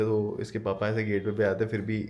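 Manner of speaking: ordinary talk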